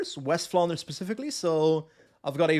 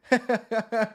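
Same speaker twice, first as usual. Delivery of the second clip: laugh; 5 semitones higher than usual. Real, not acted. Laughs at own dialect demo.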